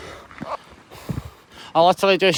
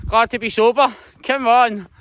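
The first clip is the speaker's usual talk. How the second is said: proud call-out; 4 semitones higher, 6 dB louder than usual. Real, not acted. Proud shout about being sober.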